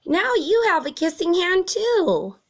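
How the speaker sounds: high, loud, proud little voice; a kid bursting with pride; excited child. A narrator's reading. Chester tells his mother, high, loud and proud.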